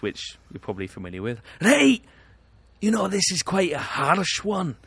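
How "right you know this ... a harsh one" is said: loud, much higher mock Scottish accent; hammed-up Scottish impression. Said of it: "then you've got Scottish".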